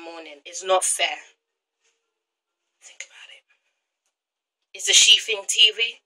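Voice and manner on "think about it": breathy whispered aside. Real, not acted. Vlogger whispering a parting thought to viewers. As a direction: whisper it like a secret.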